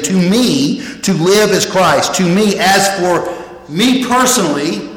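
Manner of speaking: emphatic, deliberate weight on every "me"; emphatic stress on "me". Shown as a demonstration. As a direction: hit "me" with force, making it personal. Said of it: "how the Greek word emphasizes something".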